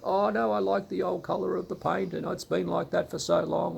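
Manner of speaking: lightly voiced set-in-her-ways churchgoer; mock stubborn parishioner. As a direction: imitate someone clinging to the old colour. Mocking parishioner resisting paint change.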